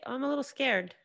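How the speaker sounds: high, mocking little voice; mocking whine. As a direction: mimic a dismissive critic, whiny and high. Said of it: "not a legitimate excuse to just say".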